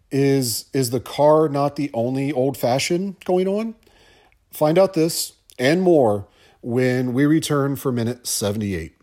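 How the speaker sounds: usual speaking voice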